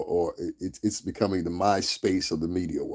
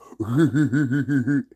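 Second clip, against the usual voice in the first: loud, high rippling mock giggle; hammed-up wobbly snicker; 5 semitones higher, 4 dB louder than usual. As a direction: giggle like a gleeful snitch. Mocks the sucker who flags him.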